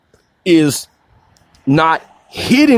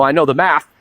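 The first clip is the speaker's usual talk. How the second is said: ordinary talk, nothing marked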